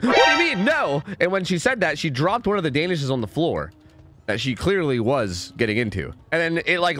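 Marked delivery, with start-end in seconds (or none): 0.0-1.0 retold roommate. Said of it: "'Cause she went".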